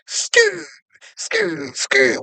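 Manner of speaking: high squealing skr, copying tires skidding in; tire screech imitation. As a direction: mimic car tires screeching to a stop. Imitating tires of arriving cars.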